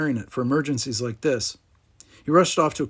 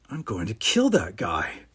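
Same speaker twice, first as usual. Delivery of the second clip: low, slow grumble; grumbling; 4 semitones lower, slower than usual. A narrator's reading. Stinson in a too-small uniform, grumbling about Riker. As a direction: grumble it darkly under your breath. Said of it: "grumbled Stinson".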